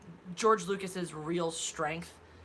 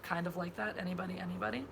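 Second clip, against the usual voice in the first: ordinary talk, nothing marked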